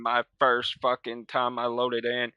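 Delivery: usual speaking voice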